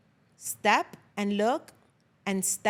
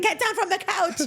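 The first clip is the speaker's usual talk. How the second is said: mock stern mom; 9 semitones higher than usual. Mom scolding on couch.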